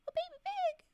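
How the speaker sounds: high delighted squeal; thrilled about the baby pig; excited. A narrator's reading. Excited exclamation in story.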